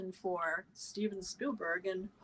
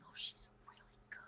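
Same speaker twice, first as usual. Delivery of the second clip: hushed, voiceless amazement; awed whisper; 22 dB quieter than usual. Retold or imitated. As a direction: whisper it in awe, slowing at the end. Retold awed reaction to an accent.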